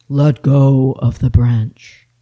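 low, loud, slow divine command; voice of God. Acted.

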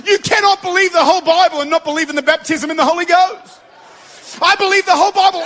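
ordinary delivery

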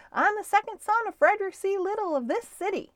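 high, quick, proud-sounding call. A narrator's reading. Stuart introducing himself from the boat, calling at full lungs. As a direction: call it out high and fast, starting loud. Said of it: "called Stuart at the top of his lungs".

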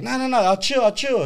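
high-pitched hasty backing down; retold pleading. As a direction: act out begging a guard to let you stay. Retelling pleading with the guard.

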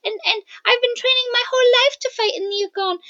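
put-on young girl voice. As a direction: speak as a fierce little girl. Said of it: "Melora's like".

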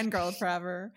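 ordinary talk, nothing marked

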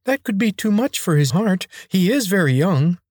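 squeaky eager servant; servile high voice. A narrator's reading. Attendant objects.

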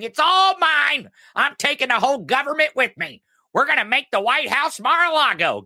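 high-pitched mocking Trump whine; mock Trump tantrum. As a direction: ham up a spoiled man-child grabbing everything.

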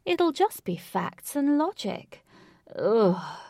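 loud, theatrical villain with stretched-out words; booming. A narrator's reading. Villain hailing his foes with love of drama, booming. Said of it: "boomed Lutz's voice".

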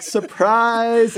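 high, bright "Surprise!" call; party crowd yelling surprise; mock surprise shout. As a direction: yell it like friends jumping out.

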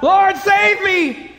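drawn-out, high, loud plea for rescue; desperate cry. Acted. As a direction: cry out slowly at the top of the voice, as if drowning. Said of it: "and he cries out".

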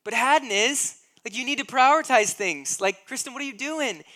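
husband venting at wife's delay, exasperated and loud; exasperated outburst. Retold or imitated. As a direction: vent at her disbelieving. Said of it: "it's like".

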